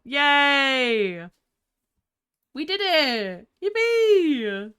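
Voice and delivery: high, loud little whoops; celebratory cheer. Real, not acted. Streamer celebrating a solved puzzle, happy high cheers. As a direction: cheer with drawn-out endings.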